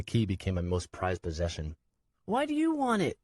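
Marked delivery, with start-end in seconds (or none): 2.3-3.2 put-on tearful child. Nico dropped off alone, high tearful child. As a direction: hold back tears as a scared boy.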